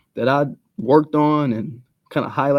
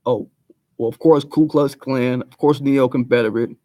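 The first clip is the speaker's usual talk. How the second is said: ordinary talk, nothing marked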